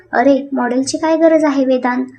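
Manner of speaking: ordinary delivery, nothing marked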